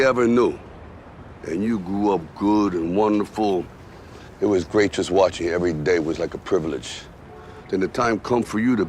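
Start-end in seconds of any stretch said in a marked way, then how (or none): 1.5-7.0 low, fond fatherly voice; a father's gentle remembering; tender reminiscence. Acted. Father tenderly recalls son's childhood.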